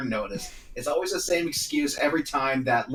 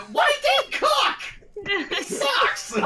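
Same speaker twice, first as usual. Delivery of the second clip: shouting; much slower than usual. Acted. Mock outburst at Dane Cook.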